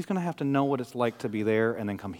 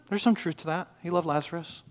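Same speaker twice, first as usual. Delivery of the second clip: normal talking voice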